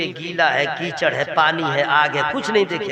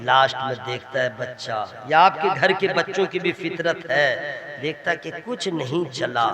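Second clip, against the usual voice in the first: normal talking voice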